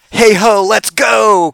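high, loud cocky chant; gung-ho party attitude; cocky cheer. Retold or imitated. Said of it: "attitude about it".

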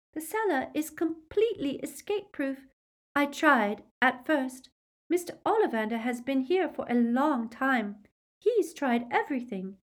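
high-pitched Luna, explaining the situation; Luna's character voice. A narrator's reading. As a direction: voice Luna well above the narration.

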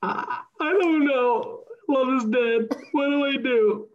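high, wavering mock-grief voice, hammed up; hammed-up sobbing fan mourning a celebrity breakup; mock emotional. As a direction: overplay the heartbreak in a high pitch. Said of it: "sorry, I'm really emotional right now".

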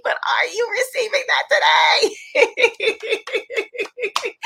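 high, loud half-shout, then a laugh; holding back a shout. Real, not acted. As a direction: burst out excited but hold it in. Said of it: "I'm trying not to shout, I don't wanna wake the baby".

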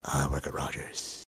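very low, soft, lifeless imitation; old-sounding worker. Imitating the Rogers guy taking his information, old and drained. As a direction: mimic a dreary old clerk, low and quiet. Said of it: "He was, like, old".

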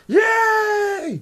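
very high shouted squeal; Muppet yay. Retold or imitated. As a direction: squeal it like a puppet. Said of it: "It's not like all of them are like".

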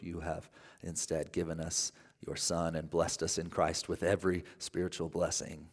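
soft quiet prayer voice. Acted. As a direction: pray softly and earnestly. Leads prayer.

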